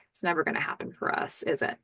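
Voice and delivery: soft, quick, half-voiced murmur; weak, resigned. Graham to Karen after a weak chuckle, softly resigned. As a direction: imitate him weakly giving up, hushed. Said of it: "He chuckles a little bit weakly".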